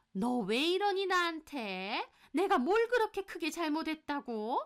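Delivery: put-on high, offended older-relative voice; indignant mother-in-law. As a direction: protest as if deeply wronged. Mother-in-law quarrels with daughter-in-law.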